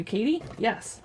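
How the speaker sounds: usual speaking voice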